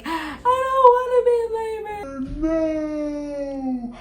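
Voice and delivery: high, wailing mock sob; imitating crying. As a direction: whine it like a panicked crying mom-to-be. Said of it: "It's chaos 'cause I'm crying".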